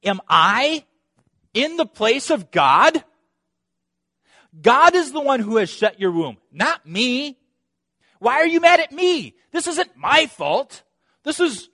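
high, loud exasperated protest; indignant Jacob. Acted. Jacob protesting to Rachel, high and loud, indignant. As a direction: defend yourself, fed up. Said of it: "Jacob then responding to her".

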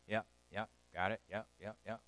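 low, soft mock "yeah yeah got it"; bored listener. Preacher mimicking a bored listener, low and dismissive. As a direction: brush it off like a half-listening hearer.

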